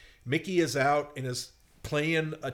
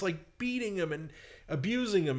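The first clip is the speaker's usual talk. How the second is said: ordinary talk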